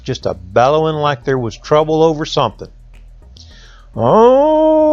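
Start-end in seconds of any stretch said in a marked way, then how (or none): 4.0-4.9 long high mooing bellow. Retold or imitated. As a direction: bellow like an old ox in trouble. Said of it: "That's my imitation of the ox bellowing".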